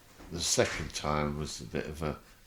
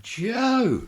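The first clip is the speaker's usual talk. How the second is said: loud; 6 dB louder than usual. Retold or imitated. Scolding friend while laughing.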